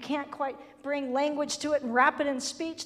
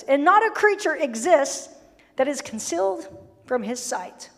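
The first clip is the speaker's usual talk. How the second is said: scripture reading; 6 dB louder than usual. Acted.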